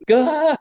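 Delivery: high, loud exasperated "gah"; a sharp cry at his own blunder; frustrated yelp. Retold or imitated. Husband caught getting the birthday wrong, yelping in frustration.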